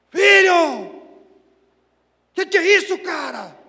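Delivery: loud, high exasperated shout; gritando com o filho. Retold or imitated. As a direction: yell at your son, fed up, at full force. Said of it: "naquele momento eu gritei tudo de novo". (bronca aos gritos)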